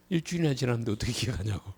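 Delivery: fast, muttered student complaint; mock student grumble. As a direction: grumble it quickly on the students' behalf. Teacher repeats the students' mock protest. (학생처럼 빠르게 구시렁대며)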